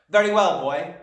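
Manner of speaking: loud, high address to a pupil; stern Trunchbull. A narrator's reading. Headmistress turning on unfortunate Wilfred, stern and loud. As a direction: bear down on the boy with authority. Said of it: "she said".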